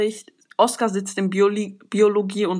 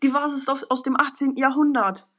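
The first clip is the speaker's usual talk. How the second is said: higher, mildly alarmed exclamation; startled grandmother; 5 semitones higher than usual. Acted. Grandmother startled by the broken old vase, alarmed. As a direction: cry out over the vase in a raised pitch. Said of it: "Die Großmutter schreckt auf und sagt".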